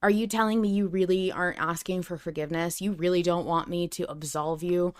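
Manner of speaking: ordinary delivery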